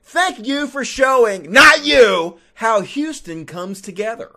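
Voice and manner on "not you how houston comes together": ordinary talk, nothing marked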